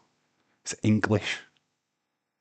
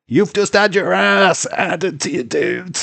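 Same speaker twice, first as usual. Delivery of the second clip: louder, much higher victorious jeer; gloating taunt; 9 semitones higher, 6 dB louder than usual. Real, not acted. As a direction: rub the win in his face. Gloating over winning the debate.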